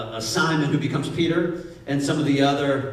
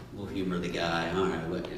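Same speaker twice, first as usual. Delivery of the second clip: low, soft, dismissive aside; humoring the guy under their breath; sarcastic aside; 11 semitones lower, 10 dB quieter than usual. Retold or imitated. Fishermen mocking Jesus quietly, low and dry. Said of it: "Jesus is that".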